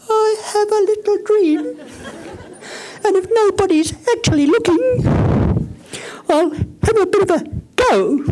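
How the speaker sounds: mock the half-hearted dreamer in a squeaky voice; timid mocking voice. Shown as a demonstration.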